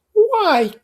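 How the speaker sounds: high, loud objecting Daisy voice; a frowning young woman's protest; high-pitched petulant objection. A narrator's reading. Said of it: "objected Daisy, frowning".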